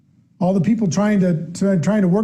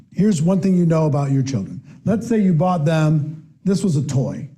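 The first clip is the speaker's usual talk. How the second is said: usual speaking voice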